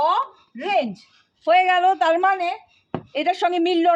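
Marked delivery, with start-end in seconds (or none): 0.1-0.9 orange broken as অ, pause, range; two separate syllables. Shown as a demonstration. Teacher counts syllables for students, orange split in two. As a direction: say orange in two beats with a gap after অ. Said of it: "দুটো syllable রয়েছে".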